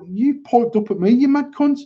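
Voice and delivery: high-pitched retold comeback; mock confrontation. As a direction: throw back an incredulous challenge, pitched high. Retelling his online argument.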